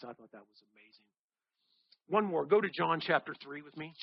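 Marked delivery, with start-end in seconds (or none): none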